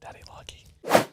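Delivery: slow whispered "Daddy likey"; cheeky whisper. Pretend. Answering the suggestion to play categories, slow cheeky whisper. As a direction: breathe it out playfully.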